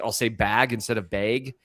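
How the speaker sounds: ordinary talk, nothing marked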